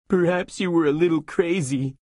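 a grieving squire pleading; Sancho sobbing. Acted. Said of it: "Sancho sobbed".

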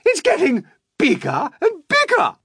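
high, loud wail of amazement; astonished cry. A narrator's reading. Aunt watching the peach swell, astonished cry. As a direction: cry it out in mounting disbelief. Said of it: "Aunt Spiker cried".

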